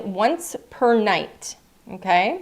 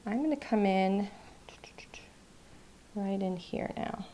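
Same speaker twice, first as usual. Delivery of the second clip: focused whisper; 9 dB quieter than usual. Real, not acted. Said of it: "I'm getting really quiet because I'm focused".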